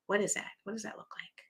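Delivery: soft breathy whisper. Acted. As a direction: drop to a secret whisper. Sudden hushed question to viewers.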